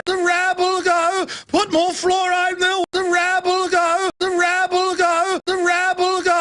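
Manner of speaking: high, hammed-up posh aristocrat sneer; mock royal voice. Host mocking the royals' contempt, high pompous sneer. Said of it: "imitating the royals".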